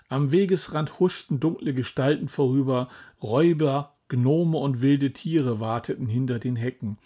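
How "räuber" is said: usual speaking voice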